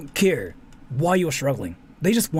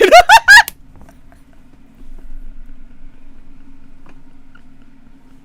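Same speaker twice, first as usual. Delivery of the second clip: long high cackling laugh that rises; loud burst of laughter; 10 dB louder than usual. Real, not acted. Loud long laugh at own joke.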